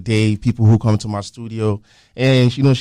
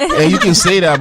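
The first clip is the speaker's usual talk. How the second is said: higher, amused concession through a laugh; 9 semitones higher, 5 dB louder than usual. Real, not acted. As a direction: give in with a chuckle. Teasing over who found whom.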